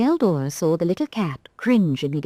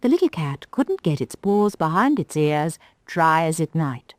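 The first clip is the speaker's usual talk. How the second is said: plain reading voice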